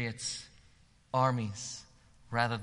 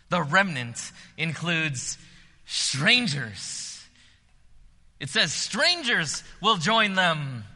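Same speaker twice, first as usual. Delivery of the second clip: preaching at full force; 10 semitones higher, 11 dB louder than usual. Acted. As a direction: lift the whole room with the invitation.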